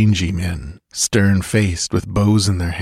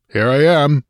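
cautious call; 7 semitones higher, 4 dB louder than usual. A narrator's reading. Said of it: "Cautiously he called".

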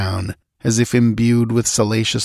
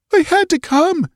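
sobbing; 4 dB louder than usual. Acted. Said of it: "she sobbed".

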